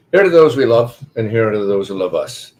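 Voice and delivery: hearty toast that lifts at the close; rousing toast. Acted. Whiskey drinker toasting the company, rousing and rising at the end.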